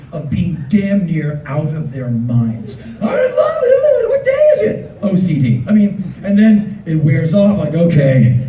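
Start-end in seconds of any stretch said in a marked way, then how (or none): 3.0-4.9 very high, loud crazed voice; manic lover. Retold or imitated. Person newly in love, very high and manic. 7.7-8.4 low, flat-out deflated; jaded sigh. Retold or imitated. Love wears off.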